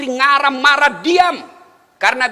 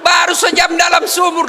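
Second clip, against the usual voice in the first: higher and louder, shouting the punchline; 8 semitones higher, 6 dB louder than usual. Real, not acted. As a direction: hit the joke's payoff hard. Punchline delivered at full volume. (teriakkan puncak lelucon dengan keras)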